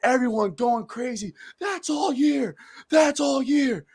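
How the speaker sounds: high yelled chant from the stands; celebratory yell. Retold or imitated. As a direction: yell it like a fan losing his mind. Retold stands celebration, yelling.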